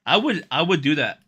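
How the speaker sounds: excited. Real, not acted. Eager reply to sex line.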